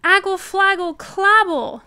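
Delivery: put-on high, loud baby gibberish; insistent toddler babble. A narrator's reading. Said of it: "said Trixie again".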